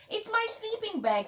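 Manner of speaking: high, lightly wailing fox; a storybook fox's mock lament; mock crying. A narrator's reading. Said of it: "a fox who was crying".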